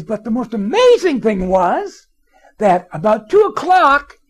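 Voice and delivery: very high, loud, slow amazement; amazed boss. Retold or imitated. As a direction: stretch every word in disbelief. Owner raves about call volume.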